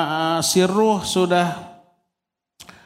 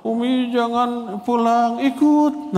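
put-on crying child; 9 semitones higher, slower than usual. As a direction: whimper like a homesick kid begging mum to stay. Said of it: "nangis gitu ya". (tangisan anak yang merengek, nada tinggi)

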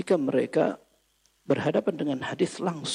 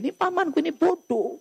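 dismissive scoff; 9 semitones higher than usual. Retold or imitated. Nephew telling Umar to ignore his uncle, scoffing. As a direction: wave the rude uncle away.

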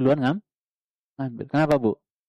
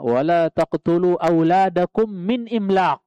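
Quran recitation; 4 semitones higher, 4 dB louder, slower than usual. Acted. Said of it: "bahasa ayatnya".

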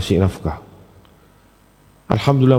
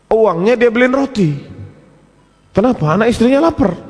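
high, emphatic preacher's storytelling; preaching; 10 semitones higher than usual. Acted. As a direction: press the point home to the listeners. (bercerita ala penceramah dengan nada tinggi)

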